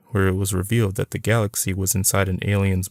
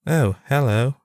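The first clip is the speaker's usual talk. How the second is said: put-on English accent; 6 semitones higher than usual. A narrator's reading. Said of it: "with an English accent".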